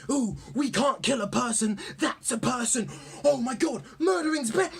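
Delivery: high, mocking whiny hero voice; mocking goody hero. Mocking fictional heroes who refuse to kill, high and whiny. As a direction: sneer it out like a preachy goody-goody. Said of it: "And they always act like".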